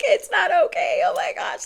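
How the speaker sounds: very high, squealed mock distress; panicked protest. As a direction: act out a squeaky, panicked objection. Retelling her panicked reply to nurses.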